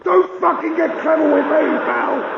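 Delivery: high, loud furious shout; raging copper; angry cop. Retold or imitated. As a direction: snap at him.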